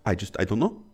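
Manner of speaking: very soft impression of a French mom; imitated French mother. Man continuing his mom impersonation, soft French mother voice. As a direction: barely voice it, as a French mother would. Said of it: "So she'd always talk up".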